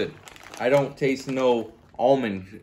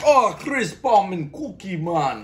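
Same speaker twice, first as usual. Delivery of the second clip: high, loud, drawn-out fake Italian; hammed-up foreign product voice; mock Italian accent; 7 semitones higher, 5 dB louder, slower than usual. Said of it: "I wasn't like".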